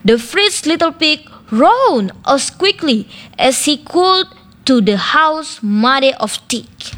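ordinary delivery, nothing marked

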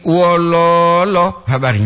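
"wololo" with a long middle lo, then habari; "lo" panjang. Shown as a demonstration. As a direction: hold the second syllable of "wololo". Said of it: "ini yang tepat". ("wololo" ditarik di "lo" tengah)

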